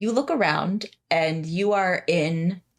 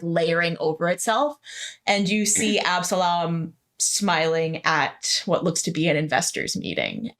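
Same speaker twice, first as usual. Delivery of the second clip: normal talking voice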